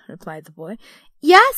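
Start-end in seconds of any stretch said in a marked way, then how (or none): 1.2-1.6 put-on high, loud, delighted child's yes; gleeful. A narrator's reading. Girl answering her brother, brimful of glee. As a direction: brim over with glee. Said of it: "brimful of glee".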